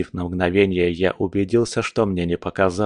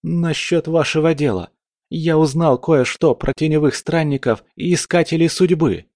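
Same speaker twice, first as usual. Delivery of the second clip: put-on nervous young man; 8 semitones higher than usual. Nervous youth keeps explaining.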